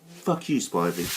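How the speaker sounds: ordinary delivery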